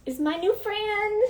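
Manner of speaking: high, slow mock-sweet tease; teasing. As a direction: lure them in with a sing-song fake-out. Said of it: "Okay, just kidding".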